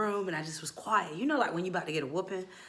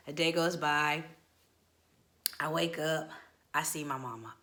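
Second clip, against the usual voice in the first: ordinary talk, nothing marked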